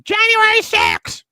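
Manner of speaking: high, loud drawn-out mock scream. Host mocking opponents over January sixth, mock scream. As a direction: screech it slowly, mocking the protesters. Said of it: "are the ones that scream".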